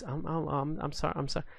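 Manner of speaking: high, stumbling apology; flustered stammer. Retold or imitated. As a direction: trip over the words, embarrassed. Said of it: "And she goes".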